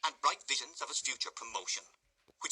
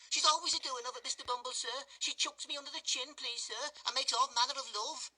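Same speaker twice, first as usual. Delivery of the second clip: blubbering. A narrator's reading. Noah blaming Charlotte to the beadle, blubbering. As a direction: whine and snivel the blame onto her.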